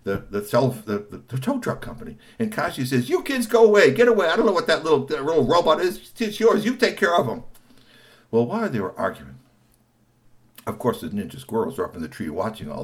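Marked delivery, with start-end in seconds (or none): 3.1-7.4 high, loud irritated shooing; shooing the kids. Acted. Kashi shouting at the kids to go away, high and loud. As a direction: chase the kids off.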